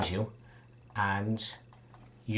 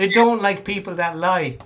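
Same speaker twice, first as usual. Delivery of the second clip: put-on high, louder indignant child; raised, childlike accusing voice; lava child; 8 semitones higher, 5 dB louder than usual. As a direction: scold them as an upset child. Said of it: "it's one of the lava children".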